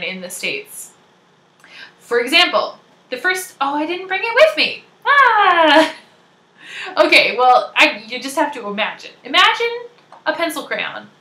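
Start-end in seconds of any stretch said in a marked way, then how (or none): 5.1-5.9 loud, high "ah" of dismay; high exasperated wail; dismayed cry. Real, not acted. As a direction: groan loudly at forgetting it. Dismay at forgetting the prop.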